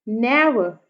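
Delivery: Never with strong ने, falling to light वर; trochaic stress. Shown as a demonstration. Teacher gives trochee example, first syllable of never stressed. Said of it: "पहले वाला syllable जो है वो stress आ रहा है".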